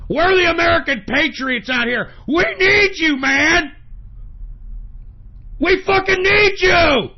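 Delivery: host begging listeners at the top of his lungs; desperate shout. Acted. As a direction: cry out for help at full volume. Calling out for patriots.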